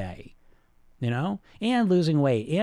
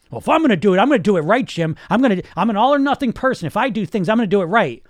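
loud, higher-pitched, insistent self-justifying; mock perfectionist attitude; 5 semitones higher, 7 dB louder, faster than usual. Dieter telling the coach they'll do it right, mock insistent. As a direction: imitate a stubborn all-or-nothing perfectionist, pushing it out loud. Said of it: "you get this attitude".

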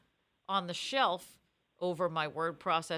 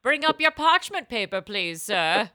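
stuffy teacher voice; 14 dB louder than usual. Retold or imitated. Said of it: "They're like".

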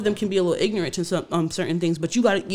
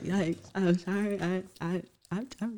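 sheepish quiet apology; 9 dB quieter, slower than usual. Retold or imitated. Retold awkward apology, very quiet.

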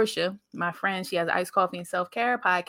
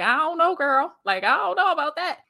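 high, hesitant mock nervousness; nervous hesitation; 8 semitones higher than usual. Before her first live, telling her friend, nervously. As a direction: hedge it like a shy first-timer. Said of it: "So I was nervous".

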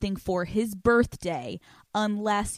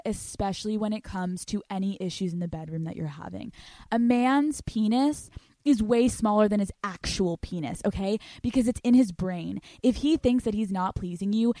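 ordinary talk, nothing marked

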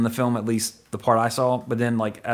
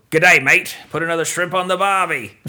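mock Australian accent; 4 semitones higher, 4 dB louder than usual. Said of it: "I'm just quoting Dumb and Dumber".

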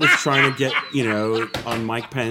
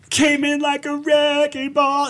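playful high voice; slower than usual. Real, not acted. Joke line, high pitched.

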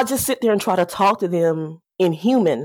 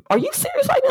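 much higher incredulous question; disbelief; faster than usual. Retold or imitated. The van owner reacts to the mechanic, incredulous. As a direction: pitch it way up like you can't believe it. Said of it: "he looked at me like".

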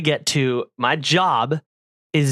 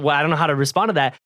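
normal talking voice

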